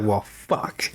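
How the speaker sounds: low, soft, deflated grumble; upset, dejected. Retold or imitated. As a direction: imitate a let-down guy, low and quiet. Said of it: "He's upset".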